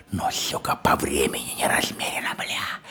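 hushed voiceless aside; whisper. Acted. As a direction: whisper it low and secret. Confiding aside.

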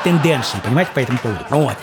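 ordinary talk, nothing marked

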